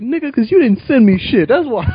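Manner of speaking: indignant retort. Retold or imitated. Retorting to Wayne.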